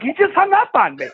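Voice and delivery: very high, mock-aggrieved voice; John Pinette, incredulous. Comedian baffled after dad hangs up, high mock disbelief. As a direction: squeal it in comic disbelief. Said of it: "And John's like".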